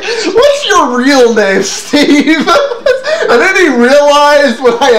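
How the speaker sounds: high, laughing teasing question. Retold or imitated. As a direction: giggle through the question.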